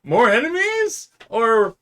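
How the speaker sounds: shouting. Acted. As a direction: shout it up to the sky. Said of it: "I'm gonna be shouting this".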